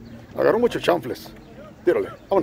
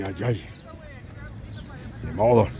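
usual speaking voice

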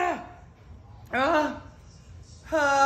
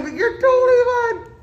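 high teary voice; crying; 11 semitones higher, 5 dB louder than usual. Real, not acted. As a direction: say it through tears. Crying while talking.

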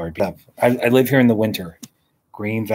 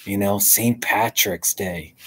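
ordinary talk, nothing marked

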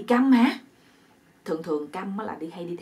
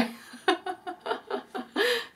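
long high-pitched run of giggles; high laughing fit; 12 semitones higher, 4 dB quieter than usual. Real, not acted. Storyteller cracking up after the captive's plea, high laughter. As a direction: laugh on in high separate bursts.

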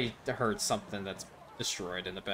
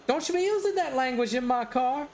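higher, sassy lady voice; scolding lady; 12 semitones higher than usual. Retold or imitated. The minivan lady tells the passenger off for swearing. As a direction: scold him like a strict mom.

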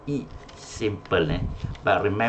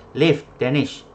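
loud, raised call to a student; 6 semitones higher, 7 dB louder than usual. Real, not acted. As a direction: call the name out to get a response. Calling on unresponsive student.

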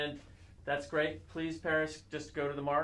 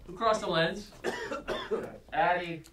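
loud demand; 5 semitones higher, 10 dB louder than usual. Real, not acted. Director angry at an intruder.

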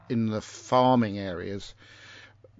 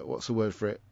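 ordinary talk

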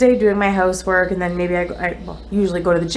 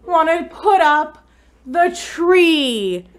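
near tears; 5 semitones higher, much slower than usual. Real, not acted. To her partner over the tree, high tearful wail. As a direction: cry out, about to sob. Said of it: "I'm going to cry".

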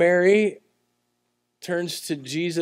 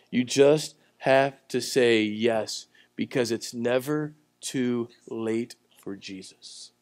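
slow and low, each word weighed; slow earnest appeal; 4 semitones lower, slower than usual. Real, not acted. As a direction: lean in and slow right down, pleading gently. Preacher slows to drive point.

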